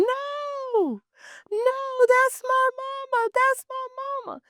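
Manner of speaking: high, anguished cry of denial; a grieving daughter's high keening protest; anguished wail. Retold or imitated. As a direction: wail it like a daughter who just lost her mama. Daughter crying at mother's casket.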